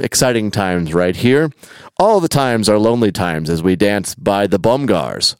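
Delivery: upbeat storyteller delivery; story narration. A narrator's reading. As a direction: narrate with big, lively emphasis.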